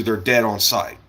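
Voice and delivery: normal talking voice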